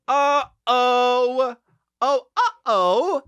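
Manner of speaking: high, slow, held uh-oh; mock alarm. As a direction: stretch out a high warning note. Alarm at her nervous condition.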